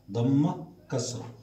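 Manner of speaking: ordinary talk, nothing marked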